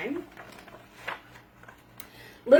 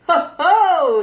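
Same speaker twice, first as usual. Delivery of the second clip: loud booming ho ho; a bear's loud laughing cry; hearty cry; 8 semitones higher, 10 dB louder than usual. A narrator's reading. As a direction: call out big and jolly like a bear. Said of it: "he shouted".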